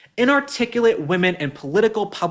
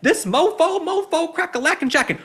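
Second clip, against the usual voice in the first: much higher, exaggerated mock voice; mocking Crockett's camera persona; 11 semitones higher than usual. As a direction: parody her on-camera slang. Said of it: "Why do you talk like that when the camera comes on?".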